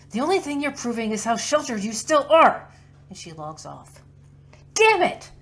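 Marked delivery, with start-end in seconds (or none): 0.1-2.7 angry yell, much higher and louder; pissed off. Acted. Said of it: "and also she's pissed". 4.7-5.3 furious shout, much higher and louder; angry curse. Acted. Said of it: "Quinn throws things angrily".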